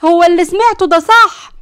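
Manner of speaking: gasp, then high loud horrified cry; shocked. A narrator's reading. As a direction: shriek out in shock at what was overheard. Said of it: "نرجس بصدمة من وراهم". (شهقة وصدمة بصوت عالي)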